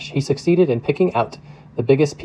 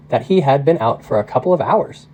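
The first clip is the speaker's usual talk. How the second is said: ordinary delivery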